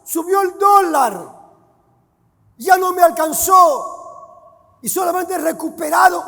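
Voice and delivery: a nervous servant explaining his losses; excusing servant. Acted. Servant excusing himself to master.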